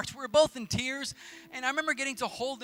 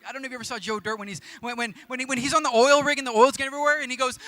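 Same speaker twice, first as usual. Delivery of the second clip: ordinary delivery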